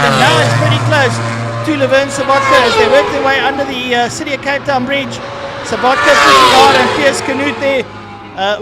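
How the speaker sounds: rising excitement, race call; motorsport commentator. Real, not acted. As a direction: call the close battle with building energy. Live race commentary building.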